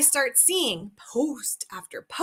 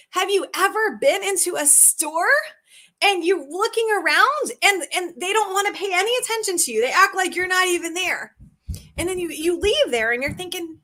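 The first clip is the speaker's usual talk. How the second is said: ordinary talk, nothing marked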